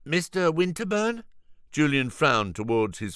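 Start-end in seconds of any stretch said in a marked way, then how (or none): 0.1-1.2 higher, fussy shopkeeper phone greeting; a harried vegan owner answering; Julian's voice. Acted. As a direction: rattle off the shop name. Julian's character voice.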